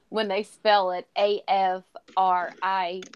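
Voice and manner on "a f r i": slow letter-by-letter spelling; slow, deliberate spelling. Shown as a demonstration. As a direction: space each letter apart.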